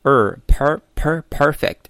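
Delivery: "per" in "perfect" slow and loud with a curled-tongue r; retroflex r. Shown as a demonstration. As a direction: isolate the r-coloured "er", then build to "perfect". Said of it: "第一个音节中的卷舌音". (卷舌慢读 er、per、perfect)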